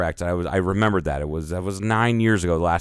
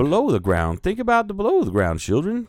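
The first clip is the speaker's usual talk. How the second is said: high-pitched mock southern drawl; playful put-on southern accent; 10 semitones higher than usual. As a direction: lift it into a hammed-up southern gentleman's drawl. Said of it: "I can't even do the southern drawl very well".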